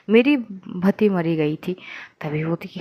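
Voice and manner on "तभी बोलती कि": plain reading voice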